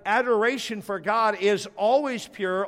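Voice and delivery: ordinary talk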